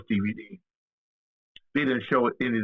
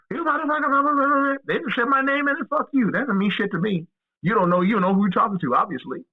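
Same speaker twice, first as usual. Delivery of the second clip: mock defiant rant; 9 semitones higher than usual. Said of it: "that person came".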